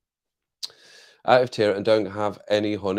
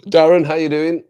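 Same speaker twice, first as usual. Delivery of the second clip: cheery greeting; 7 semitones higher, 9 dB louder than usual. Real, not acted. Greeting a viewer in chat.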